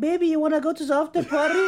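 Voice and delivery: high, fast imitated girl's yell; mock a girl calling out in a high rush; shouting. Girl inviting driver to the after party, shouted imitation. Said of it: "she was fucking shouting in my ear".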